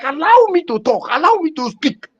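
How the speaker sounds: high, pleading protest that ends in a shout; mimicking a man fighting to speak on TV; impassioned outburst. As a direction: demand to be heard, rising to a cry of why. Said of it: "the man was like".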